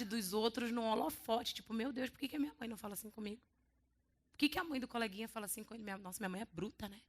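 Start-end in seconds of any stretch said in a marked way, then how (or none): 1.7-7.0 put-on quick wistful child. Child envies gentle mother.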